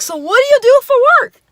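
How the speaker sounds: loud, fast, very high mock question; overzealous Bill Cosby. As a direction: fire it off like an overexcited date. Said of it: "overzealous Bill Cosby meets".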